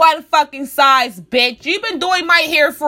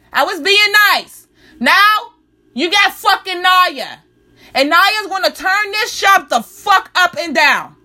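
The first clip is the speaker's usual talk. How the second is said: retold furious yelling; 6 semitones higher, slower than usual. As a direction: yell it back at the hairdresser all over again. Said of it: "I said".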